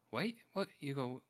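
mild puzzled surprise. Acted. Viewer glimpsing a room of horrors, puzzled. Acts out a startled viewer's reaction.

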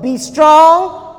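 loud, high, then slowing; emphatic quoted command. Retold or imitated. Preacher quotes the Lord's command to Joshua, emphatic proclamation. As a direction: proclaim it as a rousing charge.

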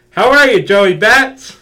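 loud, high shouted greeting; Joe LaTemplio shouting. Retold or imitated. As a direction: blast the greeting into the mic. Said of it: "you can still hear Joe LaTemplio".

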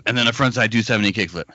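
fast deadpan list; rushed repetition. Retold or imitated. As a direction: rattle it off quickly. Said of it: "Here's my impression of Lutzka as a coach".

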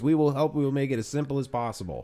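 high-pitched, accommodating customer; eager reassurance. Acted. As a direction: rush to reassure, starting high and settling down. Acting out customers reassuring the waitress.

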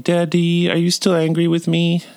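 put-on high, even little-kid voice; small son's voice. Son asking dad if he's still angry, high child voice. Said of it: "So he's like".

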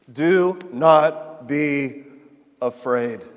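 slow, weighty, word by word; slow reassurance. Retold or imitated. Preacher dwells on Jesus' words to frightened Simon, slow reassurance. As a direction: let each word stand alone, unhurried.

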